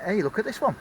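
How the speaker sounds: ordinary delivery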